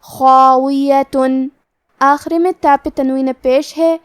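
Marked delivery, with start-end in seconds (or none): 0.0-1.5 خاویتن with a heavy خ and a heavy, long alif; very slow model reading of خاویتن; tajweed demo. Shown as a demonstration. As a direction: say خاویتن very slowly, خا thick and drawn out. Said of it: "مد الف کو بھی تفخیم سے مطلب بھاری آواز میں پڑھنا ہے".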